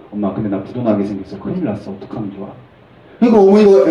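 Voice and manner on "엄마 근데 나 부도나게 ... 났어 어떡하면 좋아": low, soft plea for help; subdued confession. Acted. Brother telling mother he faces bankruptcy, subdued plea. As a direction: let the voice sink as you plead.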